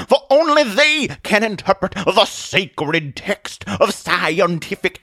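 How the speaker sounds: high, slow, fervent sermon; fire-and-brimstone preacher whipping up a crowd; fanatical preaching Atheist. Acted. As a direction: stretch key words and build to condemnation.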